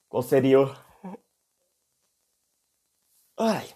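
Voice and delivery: yawning mid-sentence; words through a yawn. Real, not acted. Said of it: "mas deu um bocejo aqui do nada".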